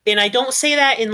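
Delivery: ordinary talk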